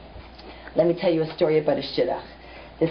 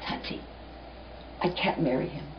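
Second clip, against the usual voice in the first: hushed plea; 3 semitones lower, 9 dB quieter than usual. Acted. Daughter pleads softly with father.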